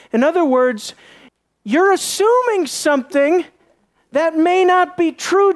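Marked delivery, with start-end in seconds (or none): 1.6-5.5 rebuking. Acted. God correcting Joshua's assumption, emphatic rebuke. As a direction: press the correction in a raised pitch.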